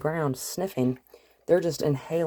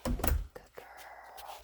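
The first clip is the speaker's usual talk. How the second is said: whisper; 9 dB quieter than usual. Real, not acted. Whispered praise.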